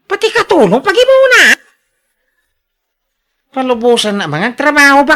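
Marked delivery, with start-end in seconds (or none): 0.1-1.6 exasperated outburst. Acted. Husband objects during argument.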